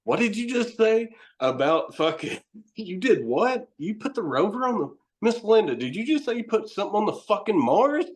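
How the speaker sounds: high-pitched, incredulous questioning; amazed disbelief. Retold or imitated. Show host questioning a scientist about a Mars rover, incredulous.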